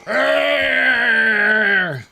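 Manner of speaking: loud, hammed-up war roar; battle roar. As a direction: roar like a caveman warrior. Battle cry in game.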